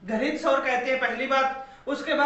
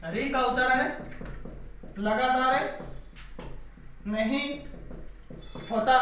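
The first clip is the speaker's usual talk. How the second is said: dictation; 4 dB quieter than usual. Shown as a demonstration. Teacher dictating a rule for notes.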